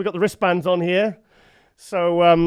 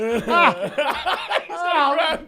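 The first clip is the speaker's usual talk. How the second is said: laughing sigh; 10 semitones higher than usual. Real, not acted. End of laughing fit.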